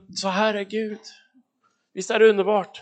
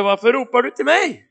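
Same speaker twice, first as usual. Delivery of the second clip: loud, raised-pitch interrupting question; God's rebuke; 7 semitones higher, 7 dB louder than usual. Acted. God interrupting Moses at prayer, loud rebuke. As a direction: cut in loudly with a challenge.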